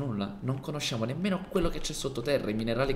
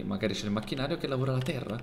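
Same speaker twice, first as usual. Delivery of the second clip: normal talking voice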